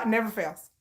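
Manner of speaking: ordinary talk, nothing marked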